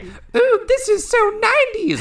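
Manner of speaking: very high, loud, mocking squeal; mock valley voice. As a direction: squeal it like a gushing fan. Mocking nostalgic fans' voice.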